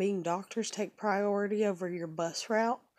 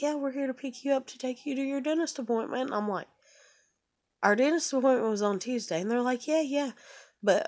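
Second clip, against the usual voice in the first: higher, offhand driver voice; breezy and dismissive, pitched up; mock bus driver; 6 semitones higher, 3 dB louder than usual.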